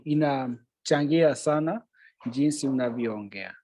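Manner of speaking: usual speaking voice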